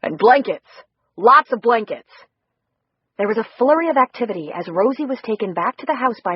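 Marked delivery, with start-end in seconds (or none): 0.0-2.1 loud call for blankets; urgent call. Acted. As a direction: shout it out over the commotion. Calling out orders at rescue.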